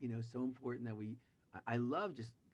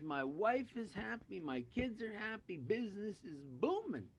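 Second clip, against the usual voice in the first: high-pitched, hammed-up happiness; acted elated bragging; gushing boast; 7 semitones higher than usual. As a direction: list the blessings in mock bliss. Joke character boasts of great life.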